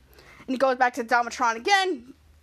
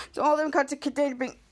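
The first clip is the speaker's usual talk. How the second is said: ordinary talk, nothing marked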